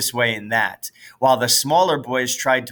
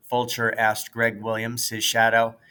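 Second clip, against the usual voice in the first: usual speaking voice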